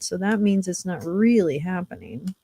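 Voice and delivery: ordinary talk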